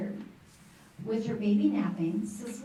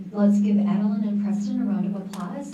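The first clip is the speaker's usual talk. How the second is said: loud, bright emcee call to the crowd; rally the room to clap; hyped-up stage announcement; announcing applause; 8 dB louder than usual. Real, not acted. Host calls for applause from stage.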